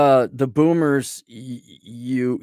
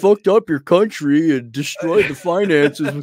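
put-on a sarcastic take on an old boomer; mock boomer apology; 2 semitones higher, 4 dB louder than usual. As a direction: play a smug boomer saying sorry.

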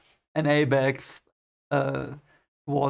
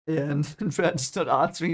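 usual speaking voice

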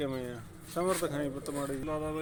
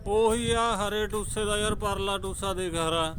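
louder, higher, insisting; hearty loud banter; 6 semitones higher, 6 dB louder than usual. Real, not acted. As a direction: call it out across the loft. Pigeon owners arguing over colours.

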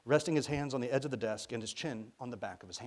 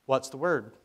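put-on loud, abrupt young man; loud and brusque; 2 semitones higher, 9 dB louder than usual. A narrator's reading. Young man answering over the intercom, brusque. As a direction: fire it out brusquely, louder. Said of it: "quite loudly and brusquely".

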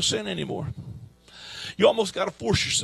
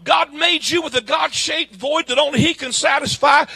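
ordinary talk, nothing marked

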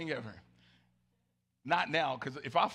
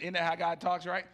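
normal talking voice

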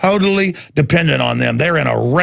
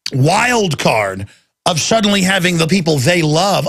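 ordinary talk, nothing marked

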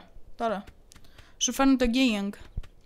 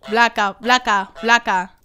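louder, punched name-calling; repeated insult; 2 semitones lower, 6 dB louder, slower than usual. Real, not acted. Player insulting the gardener, louder each time.

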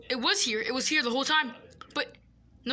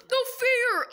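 loud, high no fair; whiny protest; 8 semitones higher, 5 dB louder than usual. Acted. Boy whining at his sister over the giveaway, loud. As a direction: protest like a sulking kid.

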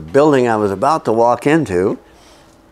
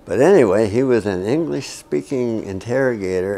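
ordinary delivery

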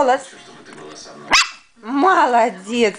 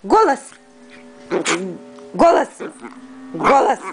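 твёрдые команды собаке; 3 semitones higher than usual. Real, not acted. Repeating the bark command.

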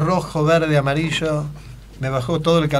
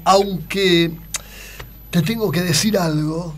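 pastor voice; 3 semitones higher, slower than usual. Retold or imitated. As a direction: hold the pastor a moment longer. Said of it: "la imitación del Pastor Jiménez".

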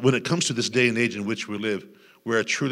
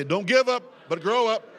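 loud and high exhortation; 12 semitones higher, 4 dB louder than usual. Shown as a demonstration. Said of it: "shake somebody's hand and say".

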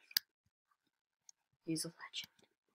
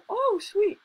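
much higher, louder squeaky cat voice; voiced cat; 5 dB louder than usual. Gamer voices his cats wrecking his setup, high squeak. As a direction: play the cat, pleased and squeaky.

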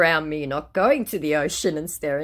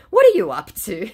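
louder higher put-on question; mock scammer question; 8 semitones higher, 10 dB louder than usual. Scammer changing the subject, mock chirpy.

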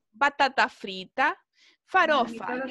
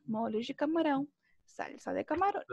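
softer lilting "Molho de camarón, salsa de camarón"; sing-song tune; 6 dB quieter than usual. Shown as a demonstration. Teacher showing students it is like a little song, soft sing-song. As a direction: recite "camarón" like a quiet little tune, rising on the last phrase. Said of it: "Es como una musiquita".

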